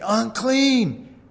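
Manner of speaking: high-pitched cry of alarm; calling out unclean. Acted. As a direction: call it out high and drawn out, as a mock warning. Acting out Pharisee calling out unclean.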